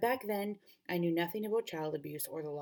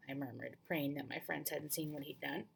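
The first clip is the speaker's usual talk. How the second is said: ordinary delivery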